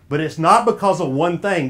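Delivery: ordinary talk, nothing marked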